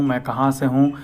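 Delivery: usual speaking voice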